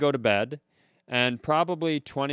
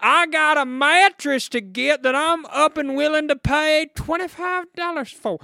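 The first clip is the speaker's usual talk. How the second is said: very high, loud caricature voice; hollering mattress buyer; 13 semitones higher, 6 dB louder than usual. Acted. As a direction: yell it as a hammed-up bargain hunter. Acting the mattress buyer yelling.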